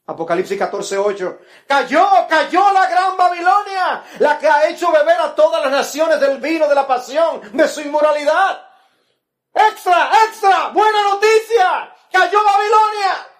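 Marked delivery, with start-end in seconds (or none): 1.7-8.7 thundering proclamation. Acted. Climactic scripture proclamation. 9.5-13.3 much higher, loud street-seller shout; slow newspaper crier yelling the big news; newsboy crying news. Pretend. Plays a newsboy crying headlines.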